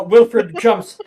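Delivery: ordinary talk, nothing marked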